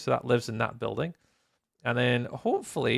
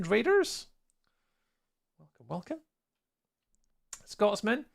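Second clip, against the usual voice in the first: ordinary talk, nothing marked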